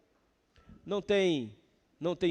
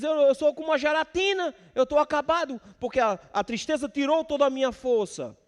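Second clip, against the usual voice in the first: a broken man pleading, pitched up and pushed; psalmist voiced; 8 semitones higher than usual. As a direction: raise the voice as the worn-out psalmist. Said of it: "Ele diz".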